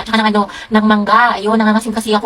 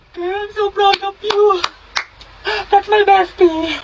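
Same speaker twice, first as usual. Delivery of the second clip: gushing slowly; 10 semitones higher, much slower than usual. Acted. Slow gushing praise.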